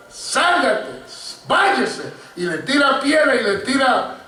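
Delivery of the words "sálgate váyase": mock yell. Preacher acting out someone chasing off a dog, mock yell. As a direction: imitate someone yelling at a dog. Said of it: "comienza a gritarle".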